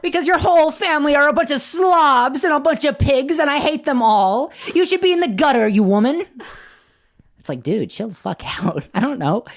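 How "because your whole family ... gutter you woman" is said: high, hammed-up scornful tirade; enraged Lady Catherine. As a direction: rant like an outraged grande dame, pitched way up. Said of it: "that's my impression of her".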